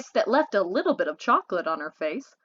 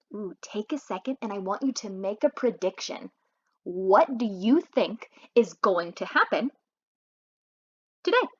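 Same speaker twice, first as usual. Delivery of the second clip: teacher aside; 2 semitones lower than usual. Acted. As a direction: pause the story and ask the children. Reader addresses listeners directly.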